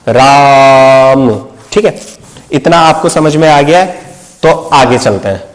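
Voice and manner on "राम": राम with a very long held aa, a bit lower; राम stretched as a pluta vowel; prolonged vowel. Shown as a demonstration. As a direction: drag out the aa of राम slowly. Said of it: "अगर मुझे राम बोलना है".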